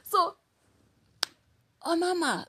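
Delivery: normal talking voice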